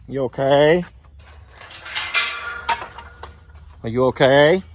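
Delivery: high sweet coaxing to an animal; coaxing pet voice. Real, not acted. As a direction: coax the bull along gently. Checking on the hurt bull.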